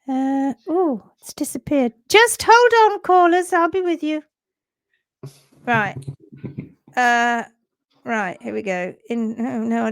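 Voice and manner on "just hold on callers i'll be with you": much higher, louder sing-song operator voice; mock switchboard operator. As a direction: ham up a chirpy call-centre greeting. Mock call-centre hold message again.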